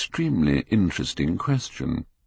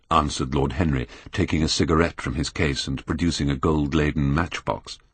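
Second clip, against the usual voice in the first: ordinary delivery, nothing marked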